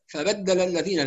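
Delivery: quick "فبدل الذين" with short vowels cut; snatched vowels. Shown as a demonstration. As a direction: demonstrate the error, rushing "فبدل الذين". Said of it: "ده اختلاس". ("فبدل الذين" مختلسة الحركات)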